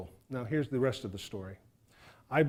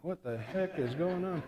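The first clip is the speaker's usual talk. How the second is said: suspicious look; 3 semitones higher, 5 dB quieter than usual. Acted. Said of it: "she gave me that pedophile look".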